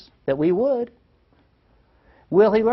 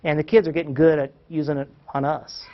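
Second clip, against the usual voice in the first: normal talking voice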